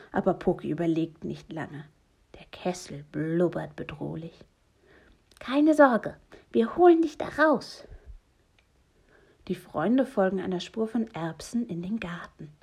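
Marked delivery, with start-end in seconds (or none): 5.4-7.9 loud, high-pitched promise; plucky, raised-voice rescuer; confident reassurance. Acted. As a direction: call out reassurance to someone trapped.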